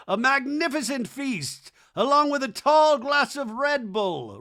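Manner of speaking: high, slow, loud herald; hammed-up feast announcement; mock-grand proclamation. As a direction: announce it like a royal banquet.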